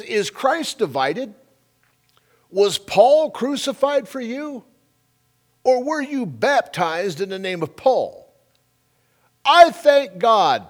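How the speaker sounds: slow, high, emphatic scripture proclamation; scripture reading. Acted. Preacher reading Paul's words to the congregation, slow and ringing scripture reading. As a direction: proclaim the verse loudly, drawing out the key words.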